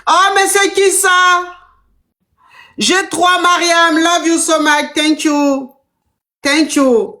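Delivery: high, slow, sugary mock sweet talk; mock the rich man's gushing; high, hammed-up gushing; mocking sugar daddy. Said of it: "il te fait signe".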